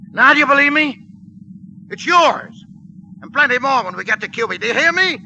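loud, high frantic bargaining; desperate pleading shout. Acted. Cornered gangster yells money offers up to soldier. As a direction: shout up begging to be believed.

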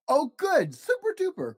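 high, gleeful exclamation; excited cry. A narrator's reading. Matu cries out gleefully to Finn, high and excited. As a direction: squeal it out with delight. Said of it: "cried Matu".

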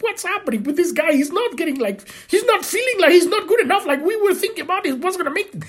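high, rushed bewildered voice; baffled onlookers. Retold or imitated. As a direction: act out the confused mockers. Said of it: "they're gonna go like".

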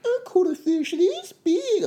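put-on high, slow, whiny German accent; drawn-out whiny German accent. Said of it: "I keep doing a German voice".

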